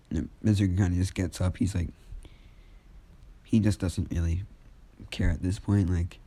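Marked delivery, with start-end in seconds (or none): none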